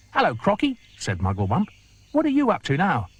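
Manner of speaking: chirpy monkey. Acted. Mugglewump greeting the crocodile, high and chirpy. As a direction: squeak it out like a monkey.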